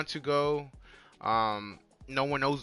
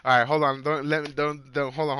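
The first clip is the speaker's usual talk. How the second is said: ordinary talk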